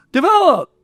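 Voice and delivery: calling a name out across a party, pitch leaping high; delighted greeting to an old friend across the room; excited call. Retold or imitated. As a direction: shout his name out in happy surprise. Duncan calls out across party.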